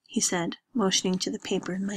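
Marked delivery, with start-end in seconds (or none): none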